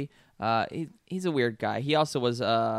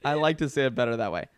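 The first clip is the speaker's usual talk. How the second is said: usual speaking voice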